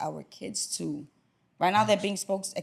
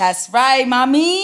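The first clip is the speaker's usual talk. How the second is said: excited cheer; 5 semitones higher, 10 dB louder, slower than usual. Real, not acted. Cohost joins the shout-out.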